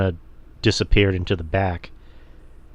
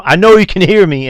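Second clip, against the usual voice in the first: loud, higher angry yell; shouting at the ref; 9 semitones higher, 9 dB louder than usual. Retold or imitated. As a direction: yell it at the referee. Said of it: "Jada's going off on the ref going".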